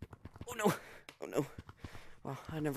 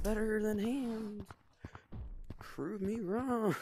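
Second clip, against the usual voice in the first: higher, slow, smug drawl; smug challenge; 9 semitones higher, much slower than usual. Real, not acted. Challenging viewers on fists versus hands, smug and slow. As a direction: dare them, slowly and smugly.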